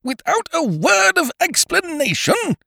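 very high, incredulous shriek; piercingly high indignant question; outraged echo. A narrator's reading. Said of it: "he echoed".

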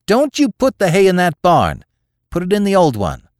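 high, loud command; forceful farmwife calling across the yard; shouted order. A narrator's reading. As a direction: order the haymakers off urgently. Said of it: "she cried out again".

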